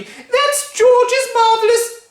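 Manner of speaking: very high, loud no; panicked cry. Acted. Missus Cranky stopping Grandma, panicked high cry. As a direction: cry out in alarm.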